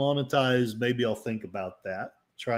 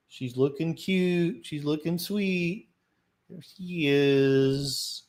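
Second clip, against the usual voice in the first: doting on dog; much slower than usual. Real, not acted. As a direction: coo over the dog with love.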